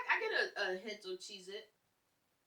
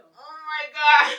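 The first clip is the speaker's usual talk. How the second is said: shocked outcry; 6 semitones higher, 21 dB louder, much slower than usual. Real, not acted. Reacting to friend's rating.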